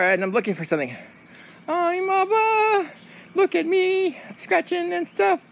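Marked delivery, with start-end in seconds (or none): 1.7-5.4 high, slow dog voice; Oliver. Pretend. Oliver the dog shows off scratching, high silly voice. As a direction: speak high and drawn out as a dog.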